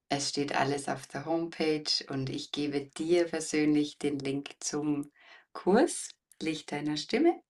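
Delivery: usual speaking voice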